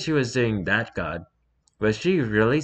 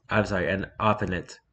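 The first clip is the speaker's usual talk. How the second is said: ordinary talk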